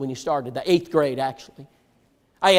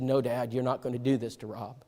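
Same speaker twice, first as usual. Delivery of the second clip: much softer and lower, steady and firm; quiet resolve; 5 semitones lower, 11 dB quieter than usual. Retold or imitated. Son shielding his friend from his father, quiet resolve. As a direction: stand your ground without raising your voice.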